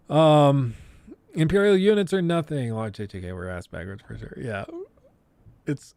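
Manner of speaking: usual speaking voice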